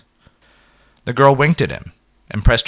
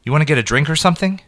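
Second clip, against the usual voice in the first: fast, higher nervous blurt; in a rush; 8 semitones higher, 4 dB louder, faster than usual. A narrator's reading. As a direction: rush it out before you lose nerve. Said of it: "Then added in a rush".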